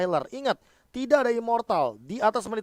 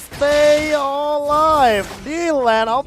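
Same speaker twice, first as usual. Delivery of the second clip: building hype; 6 semitones higher, 7 dB louder, much slower than usual. Real, not acted.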